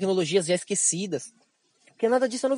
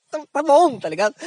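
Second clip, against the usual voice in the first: giving in; 7 semitones higher, 6 dB louder than usual. Retold or imitated.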